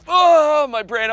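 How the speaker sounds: high, loud imitated scream of pain; mock agony yell. Audio engineer after snorting powder, hammed-up agonized yell. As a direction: yell it like the head is burning.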